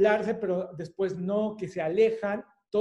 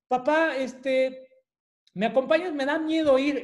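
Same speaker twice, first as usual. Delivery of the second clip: put-on scared child; 5 semitones higher, 4 dB louder than usual. Scared child asks dad.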